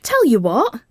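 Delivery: cheery offer to break the awkward moment; cheerily. Acted. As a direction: brighten up to change the subject. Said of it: "she says cheerily".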